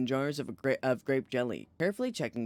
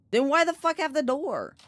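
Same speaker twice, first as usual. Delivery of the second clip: high, loud indignant shout; exasperated outburst; 12 semitones higher, 5 dB louder than usual. Real, not acted. Streamer yelling at a game door. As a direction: demand an answer, outraged and loud.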